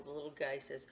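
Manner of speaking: usual speaking voice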